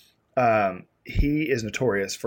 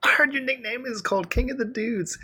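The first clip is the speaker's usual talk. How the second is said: much higher, put-on voice; high-pitched retold quote; 12 semitones higher than usual. Telling an office mate about his nickname, high put-on voice. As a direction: pitch it way up, quoting himself.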